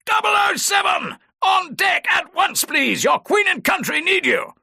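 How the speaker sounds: booming summons from the quay; bellowing. Acted. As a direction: bellow it across the deck to rouse him. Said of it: "thumping on the deck and bellowing".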